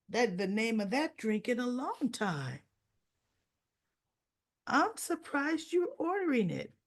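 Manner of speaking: lightly acted surprise at someone's choice; mild surprise. Anna remarks on the professor's drink order, mildly surprised.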